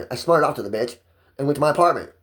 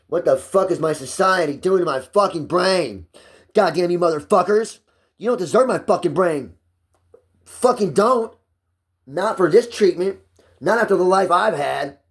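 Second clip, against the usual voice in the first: venting hard, voice climbing and straining; real angry rant; 7 semitones higher than usual. Real, not acted. Man venting at society over his medication, real angry rant.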